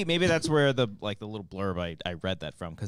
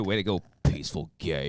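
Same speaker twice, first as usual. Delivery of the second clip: radio voice; 8 semitones lower than usual. Pretend. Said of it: "Put on the radio voice".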